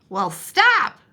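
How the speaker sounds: a loud grumpy goose snap; the goose telling Douglas off, loud and cross; grouchy goose. Acted. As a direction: bark it like a cranky goose. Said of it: "the goose grouched".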